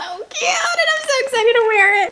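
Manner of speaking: high, squealing gush with a laugh in it, speeding up; excited squeal. Real, not acted. Gushing to viewers about a favorite bikini, high excited squeal. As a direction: squeal with delight.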